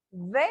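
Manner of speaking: B said alone as a low, isolated sound; letter sound demo. Shown as a demonstration. Teacher contrasting the two Bs, letter sound demo. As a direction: pronounce B by itself, pitched low. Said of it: "Diferencia entre las Bs".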